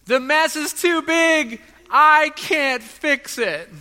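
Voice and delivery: put-on high, loud, slow wailing little girl; mock child's cry, stretched out slowly. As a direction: wail it like a sobbing six-year-old. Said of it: "she starts crying and she says".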